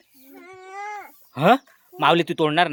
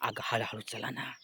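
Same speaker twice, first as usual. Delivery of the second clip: whispering while hiding; 2 semitones lower, 11 dB quieter than usual. Pretend. Sneaking up to steal mangoes.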